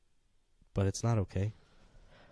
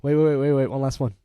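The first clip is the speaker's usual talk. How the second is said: higher, louder wait wait; urgent louder interruption; urgent call; 5 semitones higher, 8 dB louder than usual. Real, not acted. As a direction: stop them before they hang up. Calling to stop the ending.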